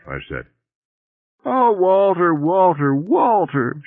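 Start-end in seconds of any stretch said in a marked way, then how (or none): 1.5-3.8 very high, wailing lament on the name; keen like a wronged bag lady. A narrator's reading. Shopping bag lady disowned by Walter, keening. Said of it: "she keened".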